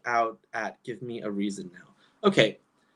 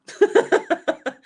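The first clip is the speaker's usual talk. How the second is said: loud high giggling burst; laughing; 9 dB louder than usual. Real, not acted. Laughing at her French skills, loud high laugh. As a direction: let out a big high laugh.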